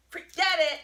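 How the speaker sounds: loud, high shout to send a dog off; excited release command. Real, not acted.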